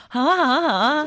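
high, loud, delighted yes; tactless eager cousin. Retold or imitated. Cousin jumping at the wine offer, high eager mimicry. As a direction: imitate an over-eager little cousin. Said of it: "我表妹特别不识趣说".

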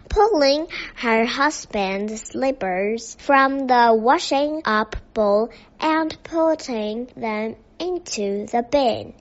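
usual speaking voice

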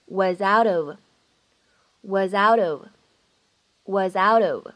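"was out of" 连读，t 发成闪音; linked with a flap. Shown as a demonstration. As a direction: join all three words of "was out of", flapping the t. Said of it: "咱们可以读成是".

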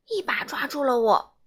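ordinary delivery

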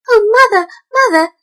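high, loud frightened cry; a scared kitten calling mother. A narrator's reading. Mittens scrambling out of the jar, high and scared.

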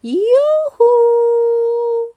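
high, loud singsong yoo-hoo; calling out. Acted. Babysitter announcing herself at the door, high calling out. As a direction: trill it out to be noticed from afar. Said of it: "and then there's a sound".

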